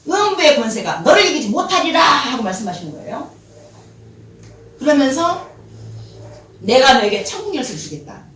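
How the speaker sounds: loud, proclaiming sermon voice; preaching at full force. Acted. Preacher proclaims Jesus' words to Peter, loud. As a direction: declare it with fervour.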